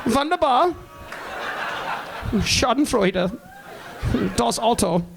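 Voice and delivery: hammed-up German words; German accent. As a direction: say them like a proud German.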